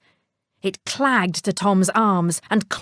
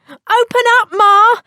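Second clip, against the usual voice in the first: high and loud, banging to be let in; urgent shout; 14 semitones higher, 9 dB louder than usual. Acted. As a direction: yell at the door for Ma. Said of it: "he shouted".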